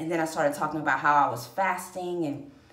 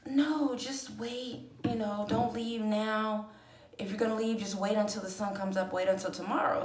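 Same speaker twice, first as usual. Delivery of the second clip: gentle calming; 4 semitones higher, 3 dB quieter than usual. Retold or imitated. Roommate calming her.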